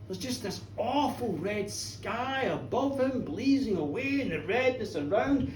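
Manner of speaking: ordinary delivery, nothing marked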